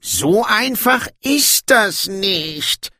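high, slow lament of a dwarf king; complaining. A narrator's reading. Dwarf king objects to trusting, complaining. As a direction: wail the objection, drawn out and high. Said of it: "klagte Brokando".

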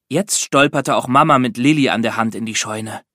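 plain reading voice

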